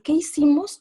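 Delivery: mock frightened question. Quoting the child again.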